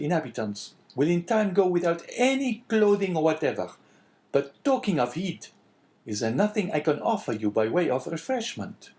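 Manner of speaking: high, eager innkeeper voice; chatty innkeeper. Acted. Innkeeper offering the priest refreshment, chatty and eager.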